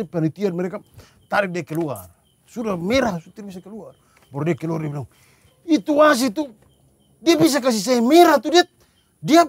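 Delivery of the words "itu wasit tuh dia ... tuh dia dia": higher-pitched, indignant retelling; mock angry protest. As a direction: imitate a player protesting the referee. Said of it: "Dia marah-marah, dia masih protes". (protes marah tiruan)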